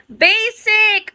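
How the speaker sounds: high happy shout of the name; excited call-out. Real, not acted. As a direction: call the name out, surprised and glad. Greeting a returning viewer.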